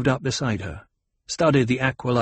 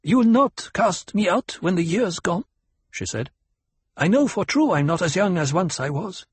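anxious tribeswoman; 8 semitones higher than usual. A narrator's reading. Hera's anxious question in character.

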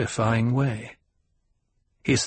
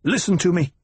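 high, urgent command; agitated insistence; 6 semitones higher, faster than usual. A narrator's reading. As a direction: demand to be heard. Agitated son insists on being heard.

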